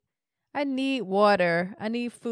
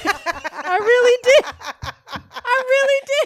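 laughing; 12 semitones higher, 4 dB louder than usual. Real, not acted. Laughing while insisting.

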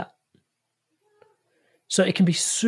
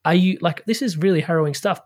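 ordinary talk

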